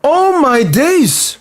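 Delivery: high, very slow drawn-out cry; stunned exclamation. Real, not acted. Fan watching the penalty missed, slow high stunned cry. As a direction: let the shock stretch out. Shock at the miss.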